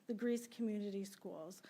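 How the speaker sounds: ordinary talk, nothing marked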